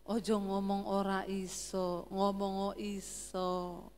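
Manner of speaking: slow, low Javanese coaxing; soft-spoken Solo husband. Retold or imitated. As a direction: correct her slowly and softly. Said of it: "Dia bilang". (suami Solo, lambat dan rendah)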